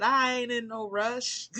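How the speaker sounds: Southern country drawl, unhurried and easygoing. Retold or imitated. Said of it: "those country people".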